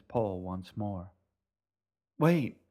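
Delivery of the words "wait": loud shouted Wait!. Acted. Boy shouting at the boatman as the boat leaves. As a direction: yell to stop the boat. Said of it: "Assam shouted".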